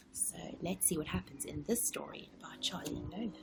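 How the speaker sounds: fast hushed whisper; whispered intro. Acted. Reader to child, whispered.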